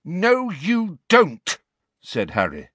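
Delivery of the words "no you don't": sudden, higher, louder, slow defiance; a boy's sudden angry outburst; loud and strong. A narrator's reading. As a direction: cut him off, loud and strong. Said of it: "his voice was suddenly loud and strong".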